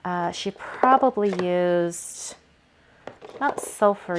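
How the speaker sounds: probably drawn out slowly, unsure; musing slowly over probably; slow unsure guessing. Shown as a demonstration. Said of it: "I'm just guessing".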